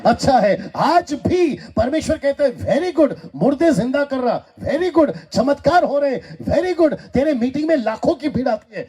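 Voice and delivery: high-pitched, rhythmic preaching chant; full-force preaching. Acted. Preacher tells the church of God's approval, high fervent preaching. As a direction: build a list in high, punchy bursts.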